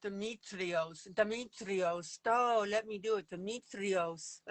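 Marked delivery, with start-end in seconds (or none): 0.0-2.2 put-on slow, drawn-out Greek accent. Trying the name with a Greek accent. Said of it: "Did I get the, the accent right". 3.3-4.4 put-on slow, careful Greek accent. Asks Gina if the Greek accent sounds right. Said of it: "Did I get the, the accent right".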